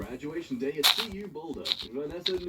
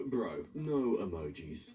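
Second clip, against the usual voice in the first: normal talking voice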